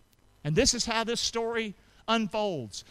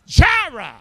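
shouted high and slow; a preacher's shout of Jehovah Jireh; proclaimed; 10 semitones higher, 11 dB louder, slower than usual. Shown as a demonstration. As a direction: ring out the name Jehovah Jireh. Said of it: "Say this word with me".

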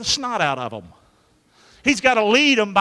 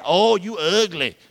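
mock snooty insult; slower than usual. Mock voice of a snob.

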